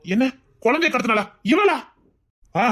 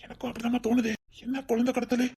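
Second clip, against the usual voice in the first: hushed aside; 8 dB quieter than usual. Acted. Father-in-law confiding to daughter-in-law, hushed aside. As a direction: keep it secret, softer.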